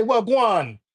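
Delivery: amateur Jamaican accent on the greeting; Jamaican patois. Shown as a demonstration. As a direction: demonstrate a Jamaican-style "wagwan". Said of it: "the way Jamaican people speak".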